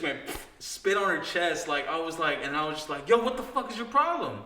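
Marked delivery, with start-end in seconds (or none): 3.1-4.4 higher-pitched, indignant confrontation, not shouted; retold angry challenge. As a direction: replay squaring up to someone. Retold confrontation after the spitting.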